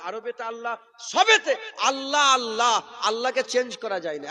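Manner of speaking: loud rhythmic refrain building to a cry; fervent preaching. Real, not acted. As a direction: preach it with rising fire.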